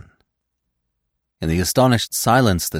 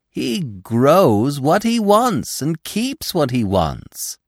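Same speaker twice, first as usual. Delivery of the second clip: put-on slow, high, emphatic young deer; proud, drawn-out explanation; Gobo, admiring; 10 semitones higher, 4 dB louder, much slower than usual. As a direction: stretch out each point with awe.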